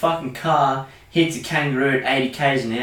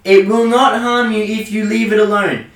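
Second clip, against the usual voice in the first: loud rant; 7 semitones higher, 4 dB louder than usual. Real, not acted. Ranting at tourists about wildlife.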